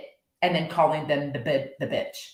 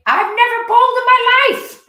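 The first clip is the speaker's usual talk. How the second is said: much higher, loud Marge Simpson voice; Marge Simpson impression; 11 dB louder than usual. Marge protesting to Homer about her birthday gift. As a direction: do Marge, indignant and high. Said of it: "I can sadly do a pretty good Marge impression".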